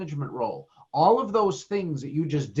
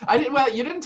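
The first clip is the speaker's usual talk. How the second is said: laughing; 10 semitones higher, 4 dB louder than usual. Real, not acted. Laughing off the plug.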